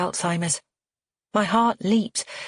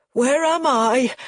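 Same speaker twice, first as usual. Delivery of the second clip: slow, high, loud frightened cry; panicked old man crying out; terrified; 8 semitones higher, 5 dB louder, slower than usual. Acted. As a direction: ask it lost and scared. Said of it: "his eyes wild with terror".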